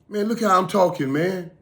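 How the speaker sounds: high, loud impression of a buddy; excited high-pitched imitation of a friend; recovered friend's voice. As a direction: pipe up high, marveling at your own voice. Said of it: "'Cause he was sounding like this".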